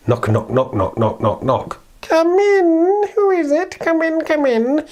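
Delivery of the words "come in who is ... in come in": very high, slow fake granny voice; wolf as grandmother. The wolf in grandmother's bed calls her in, very high. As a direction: lure her in sweetly.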